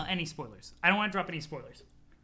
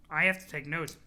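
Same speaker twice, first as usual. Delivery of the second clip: ordinary talk, nothing marked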